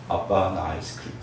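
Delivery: a forlorn orphan asking for ice cream; sad little plea. Acted. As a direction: ask quietly like a lonely child. Hungry orphan child begs father.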